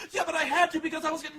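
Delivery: put-on high, fast breathless girl voice; frantic daughter. Daughter cut off by dad, high fast mock panic. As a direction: gabble it out desperately. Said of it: "She's like".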